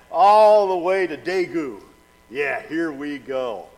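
drawn-out ride hype, loud then easing; playful slow-motion whoop; mock thrill. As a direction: stretch it out like a joking thrill-seeker. Joking about racing on bullet train.

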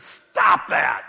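loud, stern stop; forceful stern command; firm rebuke. Acted. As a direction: cut the complainers off hard. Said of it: "Jesus comes to his defense and says".

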